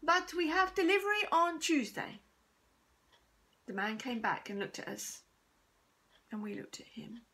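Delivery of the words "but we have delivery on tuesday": higher and louder, still calling from the corner; called out. A narrator's reading. Shopkeeper calls out the bad news to Mum, louder. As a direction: keep calling across the shop, up and loud. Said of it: "he called out".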